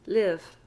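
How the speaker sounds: "Live" short i, no D at the end; clipped "Live" with a short i; model word. Shown as a demonstration. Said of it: "Notice I just said live".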